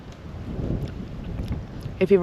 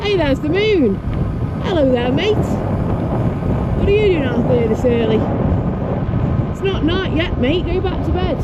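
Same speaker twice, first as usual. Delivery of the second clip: playful talk to the moon; 11 semitones higher than usual. Real, not acted.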